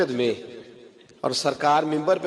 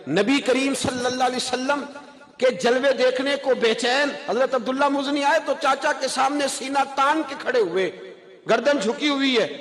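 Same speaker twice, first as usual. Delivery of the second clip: high, soaring sermon cry; fervent devotional preaching; preaching at full force; 10 semitones higher than usual. Acted. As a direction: carry the longing up to the rafters.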